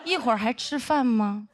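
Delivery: slow, drawn-out mock flattery; sarcastic. Senior mocking her as a big star, slow sarcastic tone. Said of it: "非常阴阳说".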